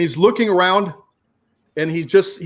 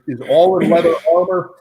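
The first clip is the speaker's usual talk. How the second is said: ordinary talk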